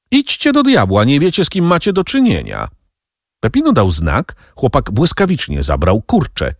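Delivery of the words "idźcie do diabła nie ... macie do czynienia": high-pitched outraged outburst; indignant. A narrator's reading. Danglars outraged by the bandits' mockery, indignant. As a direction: send them off in high-voiced indignation. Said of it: "zawołał Donglar, oburzony tymi drwinami".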